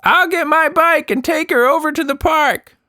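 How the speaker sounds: put-on high girl's voice calling up; a kid shouting up to a parent; calling back. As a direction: Anita calling back to the mother. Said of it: "Anita called back".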